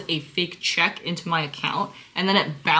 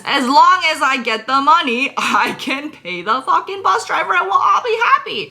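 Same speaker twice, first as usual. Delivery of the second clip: much higher, louder fed-up burst; exasperated outburst; 8 semitones higher, 7 dB louder than usual. Retold or imitated. Replying to the scammer, a loud high fed-up outburst. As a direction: blow up over the money. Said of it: "And I'm like".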